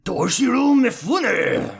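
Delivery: high, loud, drawn-out samurai cry; Toshiro Mifune. Acted. Host outdoes co-host's attempt, hammed-up samurai impression. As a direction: stretch the name out like a samurai, slow and loud. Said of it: "in your best Toshiro Mifune impression".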